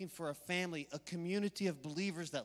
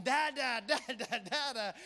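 mock baby shouting; 4 semitones higher than usual. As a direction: play the babies shouting, laughing. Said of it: "they were shouting".

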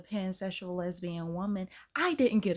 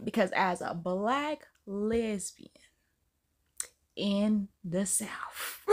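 usual speaking voice

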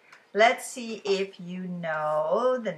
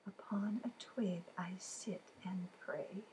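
whispered riddle line; whispering the riddle to the class; 14 dB quieter than usual. Acted. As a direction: hush it like a secret.

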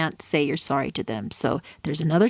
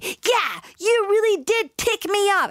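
mock hurt retort; 14 semitones higher than usual. Other person voicing hurt.

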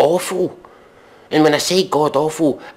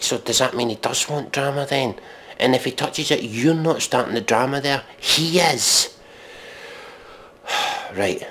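normal talking voice

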